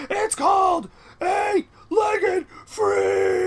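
drawn-out high shout; yelling. Acted. Announcing the movie title to a colleague, yelling. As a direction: bellow it slowly, stretching each word. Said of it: "Why are you yelling?".

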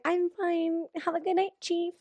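put-on high, soft girl's voice; polite brush-off. She answers the chief's check-in, high and soft.

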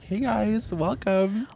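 high-pitched mock welcome to visitors; chirpy greeter. Voices their greeting at old job.

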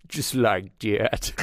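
slow, put-on modern AI voice; modern AI voice demo. Shown as a demonstration. Demonstrating the updated AI's voice in a slow put-on voice. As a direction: show how the updated AI sounds. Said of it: "updated to sound more modern".

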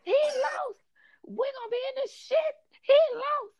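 high shrill mock wail of a distraught coworker; hammed-up wail retelling the girl's outburst; screaming coworker. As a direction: squeal it like someone losing it over the election. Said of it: "the girl screamed".

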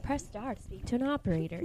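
quiet recorded phone menu line. Retold or imitated. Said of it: "that's what it reminds me of".

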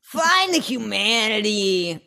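slow, drawn-out dramatic echo; hammy, lingering melodrama; mock theatrical cry. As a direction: stretch every word like an overdone movie plea. Echoes co-host's theatrical cry.

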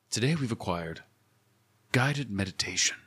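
soft, low meditation voice; guided meditation. Acted. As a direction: ease listeners in softly, with pauses. Puts on guided meditation host voice.

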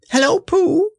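very high squeak; excited baby squeak; squeaky Roo. Acted. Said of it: "he squeaked".

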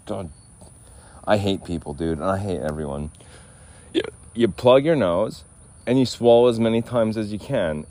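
ordinary talk, nothing marked

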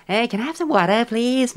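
high-pitched caricature of American English; exaggerated, teasing American accent; mocking American accent. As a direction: send up an American asking politely. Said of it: "完全にバカにしてる".

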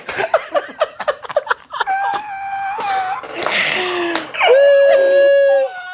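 shrieking laughter, can't stop. Real, not acted. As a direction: howl with laughter. Uncontrollable laughter after the remix joke.

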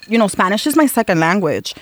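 ordinary delivery

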